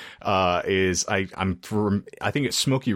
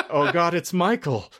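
amused surprise; 8 semitones higher than usual. Retold or imitated. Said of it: "And I was like".

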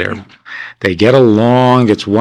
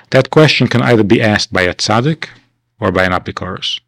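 ordinary talk, nothing marked

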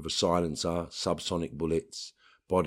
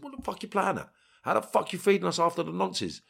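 angry confrontation; 12 semitones higher than usual. Retold or imitated. Confronting the senior officer.

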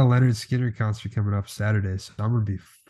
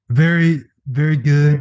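Trump impression; 4 semitones higher, 7 dB louder, slower than usual. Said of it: "exactly what Trump would say".

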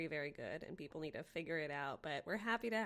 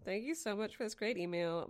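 ordinary talk

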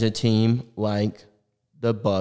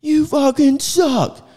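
heckling fan. Pretend. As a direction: taunt him from the stands, stretching it out. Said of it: "Angels game and be like".

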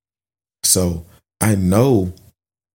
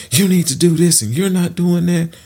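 upset scolding; 10 semitones higher than usual. Retold or imitated. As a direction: scold him like a frustrated dad. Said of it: "getting upset".

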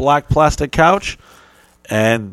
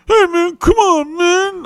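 very high, louder whine; whiny friend; 4 dB louder, slower than usual. Retold or imitated. Sock Dick Dave pleading in the hallway, high whine. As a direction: whine like a jilted buddy.

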